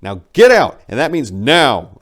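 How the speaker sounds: loud, high furious shout. Acted. Narrator shouting at the big bird, furious. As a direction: yell at the bird to get out. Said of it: "I shouted".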